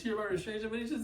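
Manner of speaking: high-pitched frantic pleading; imitating a tearful driver explaining herself; hammed-up panicked wail; mock crying. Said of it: "She is crying".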